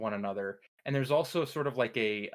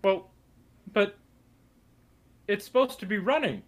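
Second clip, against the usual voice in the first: much higher, louder squeaky cultist Jerry; 8 semitones higher, 7 dB louder than usual. Acted. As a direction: pitch Jerry way up, loud and nasal-silly. DM voicing Jerry in dialogue.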